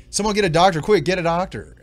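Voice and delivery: loud, high panicked call for help; frantic cry for a doctor; urgent shout for a doctor. Retold or imitated. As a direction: yell it like help is needed now. Vince running out, panicked call.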